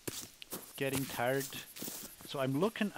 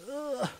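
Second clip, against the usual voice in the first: frustrated groan; 12 semitones higher than usual. Real, not acted. Shaky weapon frustrates.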